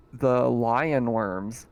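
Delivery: usual speaking voice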